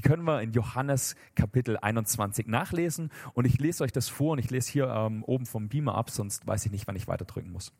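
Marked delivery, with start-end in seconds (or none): none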